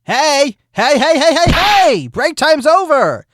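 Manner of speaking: hollering to end the break; calling out. Acted. Flash calls court back from break.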